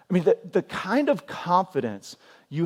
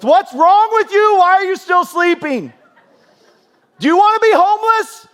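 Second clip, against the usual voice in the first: high, loud, hammed-up parental shout; over-the-top angry dad holler, pitched way up; mock furious yelling; 10 dB louder than usual. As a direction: bellow the kids awake in comic outrage. Father yelling his kids awake.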